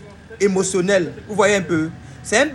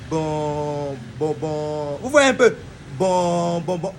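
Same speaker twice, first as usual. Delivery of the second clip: sing-song stutter; 5 semitones lower, much slower than usual. Shown as a demonstration. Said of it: "il chante en parlant".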